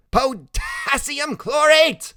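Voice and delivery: high, loud, drawn-out cry; excited shout. A narrator's reading. Freak naming the firework chemicals, shouting high and slow. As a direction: call it out slowly at a high pitch.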